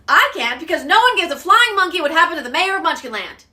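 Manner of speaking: ordinary delivery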